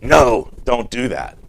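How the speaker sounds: sharp loud parent's "No"; stern critical parent. Retold or imitated. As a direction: snap at a toddler near danger. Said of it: "critical parent".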